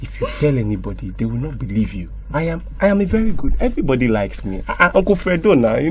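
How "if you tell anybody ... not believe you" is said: soft coaxing threat. Acted. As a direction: lower and softer, wheedling. Uncle coaxes the child.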